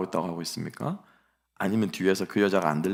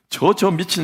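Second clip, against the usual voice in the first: loud, high mock outburst; angry outburst; 10 semitones higher, 8 dB louder, slower than usual. Pastor imitating a street bystander, loud mock outburst. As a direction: blurt it out like an outraged passerby. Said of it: "좀 생각이 깊으신 분들은".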